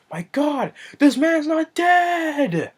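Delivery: high, stretched-out mock astonishment; mock shock. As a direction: cry out in hammed-up disbelief. Acts out shocked funeral bystander.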